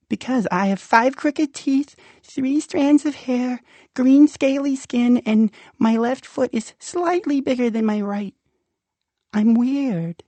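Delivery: high, timid monster voice; a shy little creature asking, high. Acted. Rumpel asks the child, high shy creature voice. Rumpel lists his oddities.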